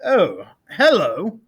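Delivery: slow, gentle greeting; a stern man suddenly melting; softened colonel. A narrator's reading. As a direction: colonel charmed by the bear cub. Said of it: "in quite a different voice".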